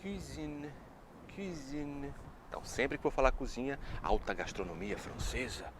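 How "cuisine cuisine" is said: cuisine with rounded pouting lips, soft; pouted cuisine. Shown as a demonstration. Teacher shows the French pout while saying it. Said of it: "faz o biquinho pra falar cozinha".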